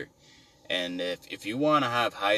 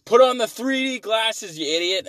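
high, loud exasperated yell at his friend; mock the buddy yelling at him in the theater; buddy's shout; 12 dB louder than usual. Buddy yells at him to put on the glasses, loud and high. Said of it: "and then he's like".

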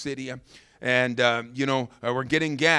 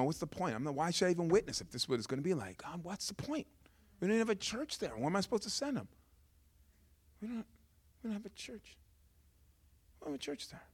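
dejected inner complaint; 6 semitones higher, 9 dB quieter, faster than usual. Retold or imitated. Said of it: "I'm so angry".

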